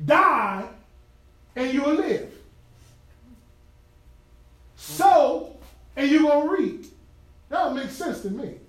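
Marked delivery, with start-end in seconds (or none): 0.1-6.8 Die and Sow hit hard and loud; preached command. Acted. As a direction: ring out each command word. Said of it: "And the command is".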